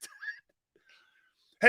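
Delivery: laughing through the words, trailing off. Real, not acted. Host laughs admitting he cannot lie about Purdy, laughing. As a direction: crack up as you say it.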